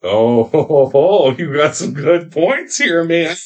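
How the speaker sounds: chuckling through the words; laughing mock praise. Real, not acted. Mock impressed laughing reply.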